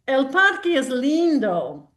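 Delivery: slow storybook line in Spanish; reading to children. Acted. Reading a Spanish line from the book to the group, slow storybook voice. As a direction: let the Spanish line land gently and slowly.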